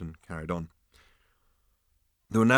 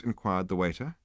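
ordinary delivery

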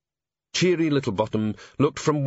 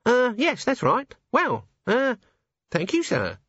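put-on high, hesitant young dwarf; timid, higher voice; nervous high voice; 12 semitones higher, slower than usual. A narrator's reading. Cheery answers flustered.

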